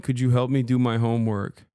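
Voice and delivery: usual speaking voice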